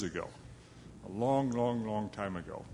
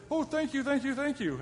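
much higher, gushing relief; high grateful thanks. Acted. Freed lion thanking the little mouse, high and grateful. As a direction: thank your rescuer with relief.

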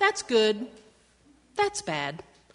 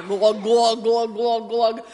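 mock gulping; 6 dB louder than usual. Giraffe drinking sound.

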